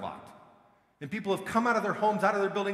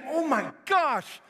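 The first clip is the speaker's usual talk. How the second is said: awestruck exclaim; 7 semitones higher, 4 dB louder, slower than usual. Retold or imitated. Awestruck believer at the rapture.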